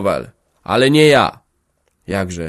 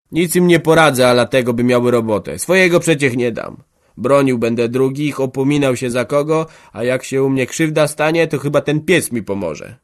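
put-on a hard old peasant, higher and louder; lightly voiced farmer digging in his heels; stubborn old farmer; 5 semitones higher, 6 dB louder than usual. A narrator's reading. Boryna refusing to help the poor, stubbornly.

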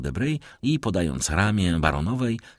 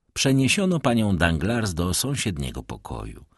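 ordinary delivery, nothing marked

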